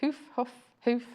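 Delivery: hoof, hoof, hoof said with a long "oo"; repeated hoof. Shown as a demonstration. Showing the other presenter how she says hoof. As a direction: chant "hoof" three times, long vowel. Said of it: "I say hoof".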